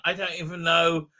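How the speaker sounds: usual speaking voice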